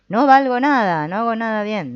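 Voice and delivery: said loud and high, almost shouting "No valgo nada". Shown as a demonstration. Therapist demonstrating the thought said very loudly. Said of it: "en voz muy baja o muy alta".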